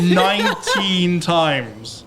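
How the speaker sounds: drawn out, laughing reveal; slow dramatic reveal. Acted. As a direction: stretch it out like a big announcement. Big reveal after drum roll.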